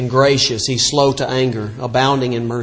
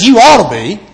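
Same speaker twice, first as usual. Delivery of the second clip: a loud, higher snap from the wife; snapped; 5 semitones higher, 6 dB louder than usual. Retold or imitated. Offended wife snaps at the apologizing preacher, loud. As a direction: bark it back like the offended wife. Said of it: "She snapped".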